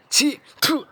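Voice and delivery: high-pitched spitting disgust; sharp, revolted exclamation. Acted. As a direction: spit it out as if revolted. Spits in disgust at the idea.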